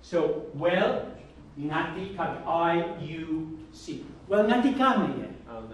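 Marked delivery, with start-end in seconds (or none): 2.4-4.0 drawn-out, separated words; slow word-by-word. Shown as a demonstration. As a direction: space out each word for learners. Teacher gives literal word order.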